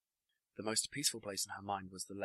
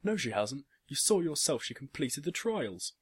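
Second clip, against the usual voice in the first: loud, raised-pitch retort; shouting back; 7 semitones higher, 7 dB louder than usual. A narrator's reading. Father defending his daughter to his boss, shouting back. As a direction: fire back an indignant shout. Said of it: "shouted her father back at him".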